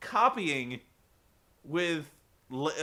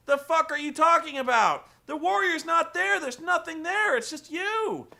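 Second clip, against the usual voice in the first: much higher, louder exasperated yelling; exasperated yelling boss; 10 semitones higher, 6 dB louder than usual. Retold or imitated. A wrestling boss yelling at his confused star, mock shouting. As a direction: shout him down in disbelief. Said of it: "And Bischoff's like".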